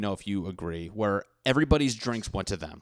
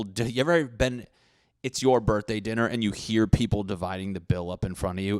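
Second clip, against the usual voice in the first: usual speaking voice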